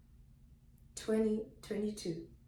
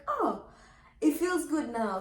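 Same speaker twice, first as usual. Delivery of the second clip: higher, pleased "oh" of relief; pitched-up sudden relief; retold relief; 7 semitones higher, 3 dB louder than usual. Labouring woman enjoying a warm shower, retold relief.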